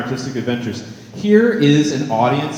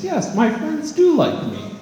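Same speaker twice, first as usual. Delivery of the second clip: relieved passerby; 13 semitones higher than usual. Retold or imitated. Voicing a reassured passerby.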